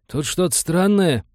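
high, slow and puzzled; bewildered report. A narrator's reading. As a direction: report it at a loss, voice pitched high. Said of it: "растерянно доложил он".

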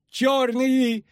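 high-pitched squeal of terror; terrified shriek. A narrator's reading. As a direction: squeal it out, far above your own voice. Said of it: "взвизгнул паромщик".

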